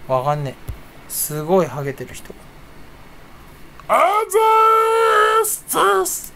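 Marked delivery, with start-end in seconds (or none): 3.9-6.3 very high, slow, drawn-out mock greeting; comedian impression. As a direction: stretch the thanks out in a silly high voice. (芸人の真似で高く間延びした「あざーす」)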